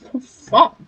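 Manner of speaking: can't believe what he heard; shocked. Real, not acted. Reacting to game plot twist.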